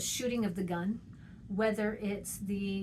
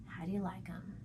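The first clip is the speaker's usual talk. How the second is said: soft unvoiced question trailing away; whispered question; 7 dB quieter than usual. Shown as a demonstration. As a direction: lean close and whisper the question. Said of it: "I'm talking".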